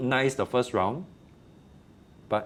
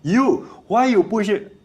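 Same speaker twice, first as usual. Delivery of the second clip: much higher, louder, slow scold; mock scolding; 11 semitones higher, 9 dB louder, slower than usual. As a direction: scold the kid sharply. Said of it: "I never scold him and say".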